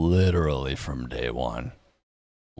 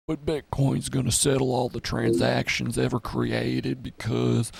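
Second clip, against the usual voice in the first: higher, slower mocking know-it-all voice; mocking a bitcoin bull; 6 semitones higher than usual. Mocking Bitcoin believers' logic in a higher, slower voice. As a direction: parody the Saylor fans, drawn out and higher.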